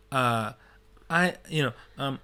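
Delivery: ordinary talk, nothing marked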